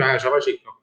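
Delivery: ordinary delivery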